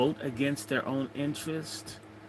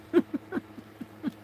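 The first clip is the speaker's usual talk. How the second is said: laugh; 3 dB quieter than usual. Real, not acted. Laughs at his own joke.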